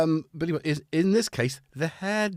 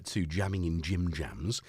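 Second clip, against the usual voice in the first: usual speaking voice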